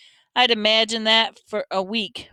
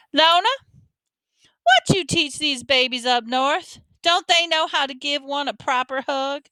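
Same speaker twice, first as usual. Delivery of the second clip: scolding Southern granny, pitched up; Grandma Sands; 5 semitones higher than usual. A narrator's reading. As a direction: chide your daughter like a fussy grandmother.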